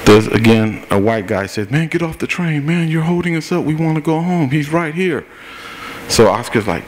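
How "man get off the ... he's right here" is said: high, whiny mock of an impatient passenger; hurried bystander voiced high and fed up. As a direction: pitch it up as the annoyed rider.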